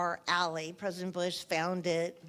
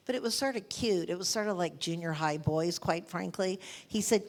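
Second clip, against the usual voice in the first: normal talking voice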